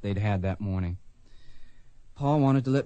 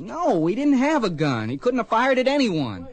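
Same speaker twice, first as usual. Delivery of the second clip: upset protest; 9 semitones higher than usual. Acted. Boy protests in court.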